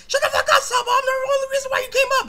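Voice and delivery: loud, very high squeaky yelling. Retold or imitated. Roaster mimicking himself yelling at Sabo, squeaky. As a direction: shriek it in a cartoonishly high pitch. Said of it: "my squeaky ass voice".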